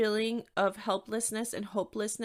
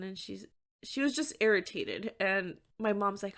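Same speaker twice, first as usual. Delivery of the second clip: ordinary talk, nothing marked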